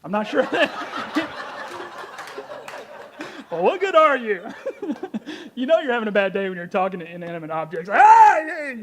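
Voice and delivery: laughing through the words. Real, not acted. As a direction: crack up while talking. Laughing at the assistant.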